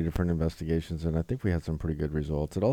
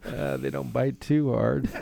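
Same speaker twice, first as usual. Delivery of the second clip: slow, laughing through the words; can't hold back the giggles; 9 semitones higher, slower than usual. Real, not acted. Repeats ad line laughing.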